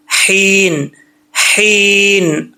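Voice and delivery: हीन held long from the throat; thin throat ha. Shown as a demonstration. Teacher drills the letter, careful held articulation. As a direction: breathe a tight h, stretch हीन. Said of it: "हा बारीक है".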